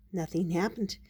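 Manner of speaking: plain reading voice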